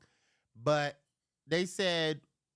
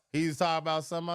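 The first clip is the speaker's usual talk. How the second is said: ordinary delivery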